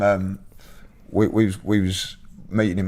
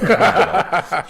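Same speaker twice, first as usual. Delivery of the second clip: laughing through a mock threat, jumping much higher and louder; 9 semitones higher, 5 dB louder, slower than usual. Real, not acted. Laughing as he jokes about knocking out the bouncer. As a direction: giggle the threat out.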